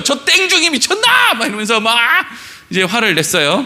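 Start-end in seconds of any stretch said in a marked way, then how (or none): 0.0-1.3 much higher, slow, indignant cursing; woman scolding the monk, high and outraged. Retold or imitated. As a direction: spit it out, high and slow, offended. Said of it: "갑자기 막 욕을 하면서".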